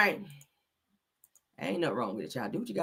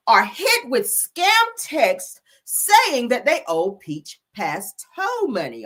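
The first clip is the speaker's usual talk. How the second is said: slow emphatic; 4 semitones higher, 4 dB louder, much slower than usual. Acted.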